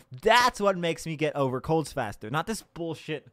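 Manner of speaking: usual speaking voice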